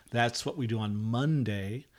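usual speaking voice

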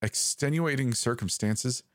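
rising, unsure ask; a ranger fumbling for an excuse; hesitant question. A narrator's reading. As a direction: offer the excuse warily. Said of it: "I said hesitantly".

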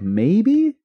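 high, hesitant mock voice; mock unsure public. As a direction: play the wavering public, laughing.

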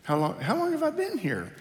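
put-on high, wavering old woman asking again; confused elderly mother. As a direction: ask it like a lost old mom. Voicing mother, high far voice.

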